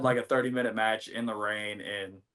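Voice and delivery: ordinary delivery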